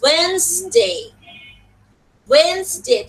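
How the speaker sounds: "Wednesday" said as WENZ-day, without the first d, clear and separate; clear pronunciation model. Shown as a demonstration. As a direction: model "Wednesday" slowly with the d silent. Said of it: "speak it loudly, clearly, slowly". (স্পষ্ট করে, ডি ছাড়া "Wednesday")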